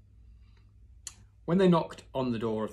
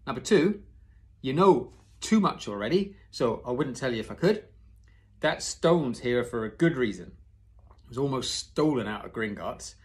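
firm Hagrid; 3 semitones higher, 7 dB louder than usual. Acted. Hagrid refuses, firmly.

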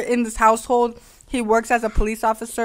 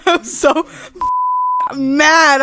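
laughing; 5 semitones higher, much slower than usual. Real, not acted. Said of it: "laughs heard on line".

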